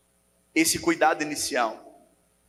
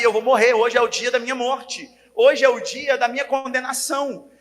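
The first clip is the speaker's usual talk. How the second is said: desperate cry; 5 semitones higher, 4 dB louder than usual. Retold or imitated. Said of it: "ela já sabia".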